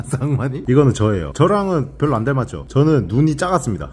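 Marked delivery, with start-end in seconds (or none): none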